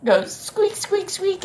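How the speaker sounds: high squeaky squeak squeak; mouse squeak. Shown as a demonstration. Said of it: "this little animal goes".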